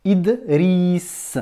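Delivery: Idriss split after Id, ending on a long S; sounded out. Shown as a demonstration. Teacher lets pupil hear today's letter, stretching Idriss to its S. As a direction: break Idriss and hold its final S. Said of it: "on l'entend bien dans Idriss".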